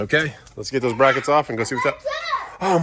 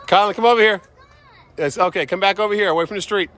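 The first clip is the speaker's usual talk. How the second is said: calling out; 8 semitones higher, 5 dB louder than usual. Real, not acted. Calling child away from the street.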